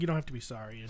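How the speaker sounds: low, soft retold reply; soft reassurance. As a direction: brush off the apology quietly.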